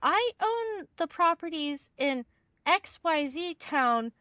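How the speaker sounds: a hint of a baffled landlord correcting her; imitating the confused client. Landlord telling the caller he doesn't own Wisconsin properties, mildly puzzled. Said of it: "is like".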